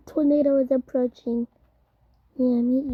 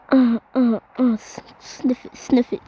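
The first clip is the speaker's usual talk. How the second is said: ordinary talk, nothing marked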